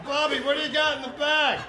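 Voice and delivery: high ribbing question; friend's teasing voice. Retold or imitated. Friends teasing him on the walk home, high ribbing. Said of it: "friends of mine".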